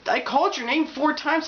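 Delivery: high-pitched protesting excuse; mock defensive apology. Acting apology to startled reader.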